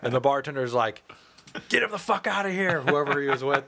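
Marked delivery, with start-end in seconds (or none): 1.7-2.8 higher-pitched imitation of an angry bartender; retold yell at no great volume; mock yelling. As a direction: play the pissed-off bartender throwing someone out. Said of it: "start yelling".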